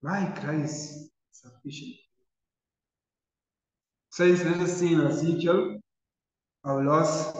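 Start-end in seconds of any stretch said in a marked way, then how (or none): 0.0-2.0 soft, reassuring words, much softer; tender hush. Retold or imitated. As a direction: comfort them gently, almost in a hush. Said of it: "And Jesus cried out".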